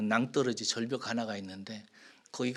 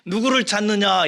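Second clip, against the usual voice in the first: louder and higher, stressing 누구를; emphatic calling question; 7 semitones higher, 8 dB louder than usual. Shown as a demonstration. Preacher voicing Jesus to weeping Mary, ringing and emphatic. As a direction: press hard on 누구를 with a raised, ringing voice. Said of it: "이 소리가 아니에요".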